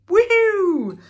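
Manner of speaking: high, loud woohoo; excited cheer. Real, not acted. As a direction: cheer it out big. Cheering for the prize.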